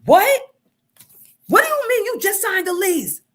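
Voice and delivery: high mock outrage, loud; imitating angry husband. Husband yelling at wife in therapy, mocked high and loud. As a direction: ham up his angry yelling.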